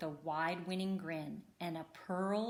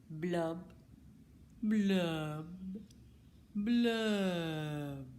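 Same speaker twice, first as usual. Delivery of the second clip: glum fish blubs; much slower than usual. Acted. Glum bubbling refrain.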